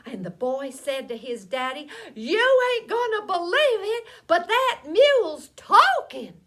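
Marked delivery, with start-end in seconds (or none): 2.2-6.4 high, loud, alarmed voice; spooked boy. A narrator's reading. Boy telling his daddy the mule talks, alarmed. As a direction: blurt out the unbelievable news.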